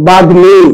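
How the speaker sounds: বাগ্মী said with the m kept, bag-mi; pronunciation demo. Shown as a demonstration. Teacher modelling the word for students, m kept. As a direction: say বাগ্মী clearly and keep the m. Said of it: "এটার উচ্চারণ কি হবে".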